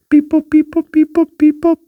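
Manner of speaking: high squeaky beep boop; robot beeps. Pretend. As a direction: chirp it out like a little robot. Playful transition between questions.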